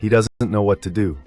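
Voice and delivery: ordinary delivery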